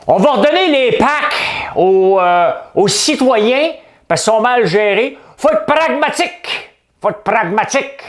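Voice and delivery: high, loud, slow pompous mimicry; mocking politician voice. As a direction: drawl it like a smug politician. Mocking Coderre's speech.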